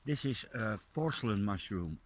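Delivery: normal talking voice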